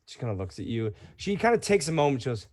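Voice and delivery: ordinary talk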